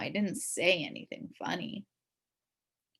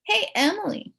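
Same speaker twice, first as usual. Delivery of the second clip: calling out; 13 semitones higher, 7 dB louder than usual. Acted. Calling a classmate.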